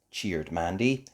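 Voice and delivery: ordinary delivery, nothing marked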